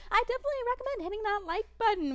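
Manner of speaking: put-on high, fast, bubbly anime girl voice; cute anime streamer. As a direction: sell the like button like a cute idol. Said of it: "if you like my general vibe VTuber voice".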